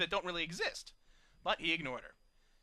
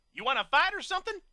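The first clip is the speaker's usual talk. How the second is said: high, loud taunt; challenging shout; 11 semitones higher, 9 dB louder than usual. A narrator's reading. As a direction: dare him to fight. Johnny challenges the big pony.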